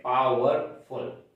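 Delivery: normal talking voice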